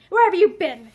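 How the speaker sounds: loud, sharp demand. A narrator's reading. Drunk husband confronting his wife, loud and demanding. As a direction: raise the voice and demand an answer.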